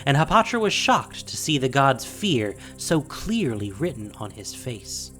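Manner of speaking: usual speaking voice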